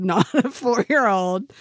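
normal talking voice

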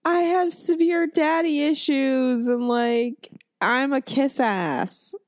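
high, drawn-out mocking voice; mocking Wesley. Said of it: "He's just like".

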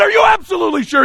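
very high, fast, loud challenge; shouting back. Retold or imitated. Speaker pressing the ticked student harder, shouted challenge. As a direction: escalate into a yelled question.

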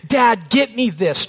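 lightly voiced small child asking for something; daughter's demand. Dad quoting his little daughter, lightly voiced demanding child. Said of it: "my daughter says to me".

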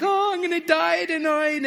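put-on high, loud wail of a scared little boy; play-cry the words like a frightened four-year-old; mock crying. Boy at bedtime wails to his brother in mock tears. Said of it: "And then I started crying".

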